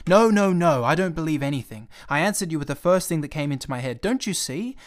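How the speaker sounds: loud, fast annoyed protest; exasperated outburst. Acted. Pilot annoyed at the prince's questions, loud and fast. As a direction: shout him off, too busy to listen. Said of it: "I cried".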